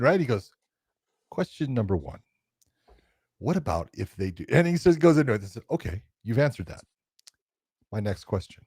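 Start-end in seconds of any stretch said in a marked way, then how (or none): 1.3-4.4 soft, deliberate questioning with long pauses; measured, lower-key imitation of a coach; John Danaher. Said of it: "that's the way he talks". 5.7-8.6 quiet, methodical interviewer imitation; measured and soft, pausing between phrases; John Danaher. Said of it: "that's the way he talks".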